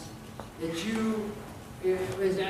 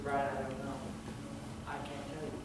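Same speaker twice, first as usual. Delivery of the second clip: very soft, low admission; hushed helpless answer; 5 semitones lower, 12 dB quieter than usual. Retold or imitated. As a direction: answer a grieving friend quietly, without answers. Answering a grieving neighbour.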